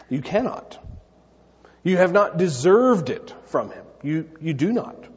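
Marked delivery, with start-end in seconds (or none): none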